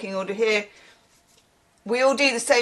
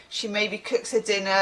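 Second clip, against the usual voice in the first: normal talking voice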